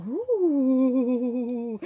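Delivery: long, loud cooing "ooh"; delighted ooh. Real, not acted. Vlogger seeing a pretty gift, long delighted ooh. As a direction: draw out an admiring ooh.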